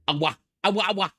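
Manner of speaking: VTuber voice. Pretend. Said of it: "falling into the VTuber trope".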